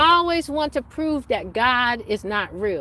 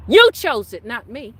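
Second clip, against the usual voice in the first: loud rebuke; 7 dB louder than usual. Real, not acted. Telling Lucifer he chose it, loud rebuke. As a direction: throw it back at him loudly.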